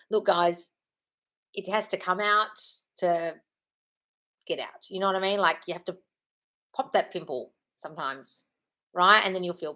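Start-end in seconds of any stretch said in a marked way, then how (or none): none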